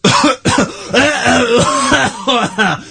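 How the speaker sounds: real choking cough; crisp caught in the throat, coughing; coughing fit. Real, not acted. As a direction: cough hard to clear the throat. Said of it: "crisps have gone down the wrong way".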